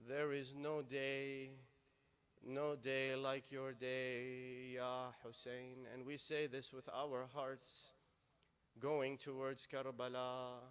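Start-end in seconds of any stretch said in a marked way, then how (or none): 0.1-5.9 slow, lingering delivery; slow mournful line. Acted. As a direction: draw every word out in grief. Translating the lament line.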